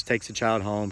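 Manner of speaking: ordinary talk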